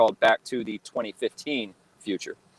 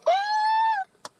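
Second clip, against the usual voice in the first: very high-pitched imitated fan scream; thin, sky-high mock scream; fans' freak-out scream. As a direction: squeal like an overwhelmed fan.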